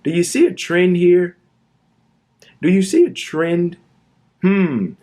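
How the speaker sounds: pointed, mocking rhetorical question; sarcastic question. Real, not acted. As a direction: rub the obvious in viewers' faces.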